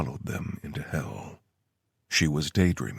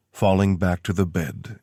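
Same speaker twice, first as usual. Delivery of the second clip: usual speaking voice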